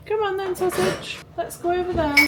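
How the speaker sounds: high, light cajoling. Real, not acted. Mum moving baby over there, high affectionate coaxing.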